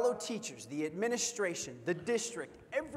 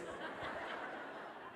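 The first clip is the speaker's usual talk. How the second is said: mock mocking laugh; 12 dB quieter than usual. Girls laugh at them.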